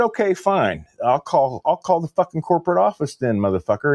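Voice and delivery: high-pitched exasperated comeback; retold angry threat. Customer retelling his threat to call corporate, exasperated.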